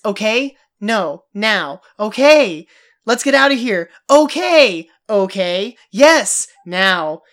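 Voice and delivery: acted bickering, building to loud bursts; increasingly agitated. Podcaster acts out two characters snapping back and forth, increasingly agitated. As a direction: trade testy one-word retorts, each one punchier. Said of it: "getting more agitated".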